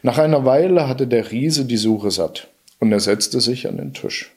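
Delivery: usual speaking voice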